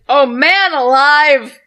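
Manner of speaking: slow booming shout of man alive; loud drawn-out exclamation. Acted. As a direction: bellow it out slowly.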